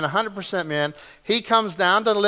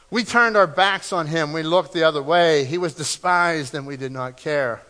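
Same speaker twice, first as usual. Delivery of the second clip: ordinary talk, nothing marked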